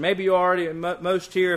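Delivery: usual speaking voice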